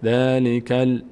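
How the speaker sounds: ذَلِکَ ال- level, closing on L with no vowel after; unvowelled lam demo. Shown as a demonstration. Teacher correcting "kalle" to a still lam, careful demo. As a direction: stop on the L of ذَلِکَ ال-, no added "e". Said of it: "کله نشه".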